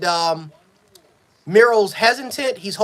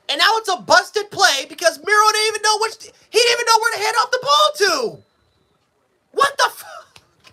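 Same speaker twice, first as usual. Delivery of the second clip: furious screaming; 10 semitones higher than usual. Real, not acted. Fan screaming at his team's busted play, furious shout. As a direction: scream it out as the play falls apart. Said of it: "I apologize for all the screaming".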